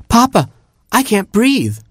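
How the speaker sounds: very high, gasping little plea; panicky Gerbil. A narrator's reading. Gerbil to Papa in the trapped cave, panicky. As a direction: squeal it to Papa in alarm. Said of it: "mumbled Gerbil".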